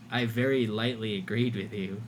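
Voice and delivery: usual speaking voice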